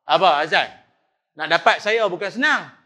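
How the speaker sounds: loud indignant outburst; indignant protest. Retold or imitated. Preacher loudly protesting to the bilal about stopping for the azan. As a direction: protest being cut off mid-talk.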